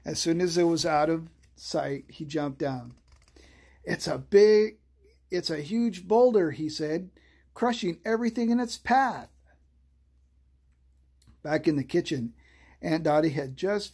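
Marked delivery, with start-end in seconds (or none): 3.8-6.5 excited. A narrator's reading. Scared child reports the monster. 7.5-9.3 excited. A narrator's reading. Scared child reports the monster.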